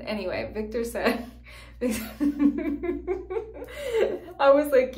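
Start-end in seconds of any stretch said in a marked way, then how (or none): 1.3-4.3 high cackling laugh. Real, not acted. Cracking up telling viewers the joke, high laugh.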